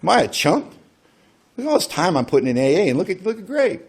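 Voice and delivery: high, whining self-pity; mock indignant whine of a jealous friend; exasperated inner voice. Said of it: "I'm thinking".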